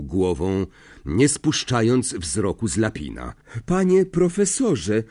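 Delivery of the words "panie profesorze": higher-pitched, insistent address; raised, urgent boy's voice; speaking up. A narrator's reading. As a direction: pipe up to get the professor's attention. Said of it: "powiedział głośno Harry".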